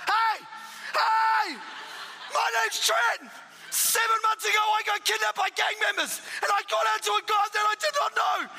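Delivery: a new convert testifying at the top of his voice; fervent testimony. Retold or imitated. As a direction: blurt out your story to the room. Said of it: "I just stood up".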